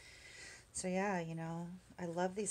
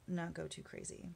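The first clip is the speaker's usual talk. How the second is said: ordinary delivery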